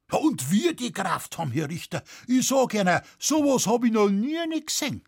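high, emphatic rustic exclamation; excited farmer, rising in pitch and volume; Bavarian peasant witness, amazed. Acted. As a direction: marvel at the brawl in broad dialect. Witness describes the fight.

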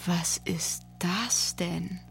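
slow whispered disbelief; barely able to ask, stunned. A narrator's reading. Aton holds the empty foil, whispering in disbelief. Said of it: "fragte er fassungslos".

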